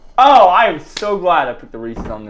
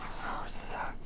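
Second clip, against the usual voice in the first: breathless whisper of defeat; barely voiced, whisper it to yourself; 21 dB quieter than usual. Real, not acted. Losing the guessing round.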